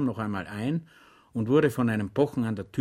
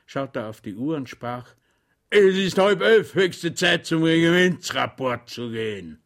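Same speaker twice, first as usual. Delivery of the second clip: put-on high, loud, blustering old colonel; grumpy rambling colonel; 8 semitones higher, 6 dB louder than usual. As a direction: lecture him pompously and grumpily. Colonel pronounces the punishment. (mürrischer, polternder Oberst)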